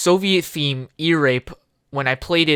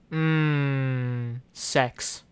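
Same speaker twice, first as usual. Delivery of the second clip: lower, softer hum; mock sultry; 5 semitones lower, 8 dB quieter than usual. Goofing, mock sultry murmur. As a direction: purr it low.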